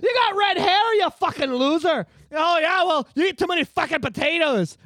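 loud, high-pitched trading of insults; mock quarrel. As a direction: hurl insults like a comic brawler. Acted quarrel between feuding British Isles peoples.

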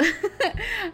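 bright short laugh; light laugh. Real, not acted. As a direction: laugh at your own pitch. Laughs after playful push.

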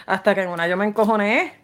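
ordinary talk, nothing marked

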